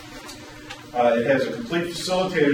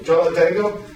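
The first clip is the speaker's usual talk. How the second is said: tentative question; 3 semitones higher, 5 dB louder than usual. Retold or imitated. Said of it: "he goes".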